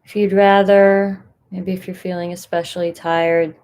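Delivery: unhurried guidance with long pauses between phrases; guided meditation. Acted. Meditation leader inviting listeners to find a comfortable position, guided meditation. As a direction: settle listeners in, leaving space after each instruction.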